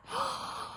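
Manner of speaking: sharp high gasp; excited gasp. Shown as a demonstration. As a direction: gasp in delight. Mum sees the money.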